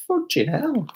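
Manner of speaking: high frustrated yelp; exasperated squeal. Real, not acted. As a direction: squeak it out in annoyance. Frustrated at pizza cost.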